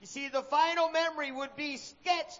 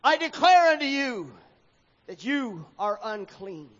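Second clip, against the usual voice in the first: priest's declaration; 5 dB louder than usual. A narrator's reading. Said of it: "jumped back with a wild look".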